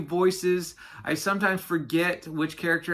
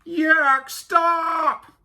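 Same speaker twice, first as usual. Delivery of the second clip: high, loud, drawn-out groan; groaning Walrus; 13 semitones higher, 6 dB louder than usual. A narrator's reading. Walrus trying to halt Yak's chatter, groaning loudly. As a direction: groan it out as a desperate shout. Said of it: "groaned Walrus".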